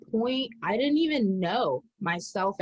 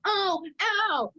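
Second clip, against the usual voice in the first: high, hammed-up ow; imitating her old self's high fake ow; mock hurt cry; 10 semitones higher than usual. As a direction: whimper high as if poked.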